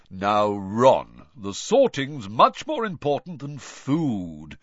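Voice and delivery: slow, proper scolding ghost; prim ghost voice. Acted. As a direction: gently lecture like an old gentleman. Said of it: "said nearly headless Nick".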